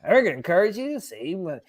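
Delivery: infomercial voice. Pretend. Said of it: "I sound like an infomercial".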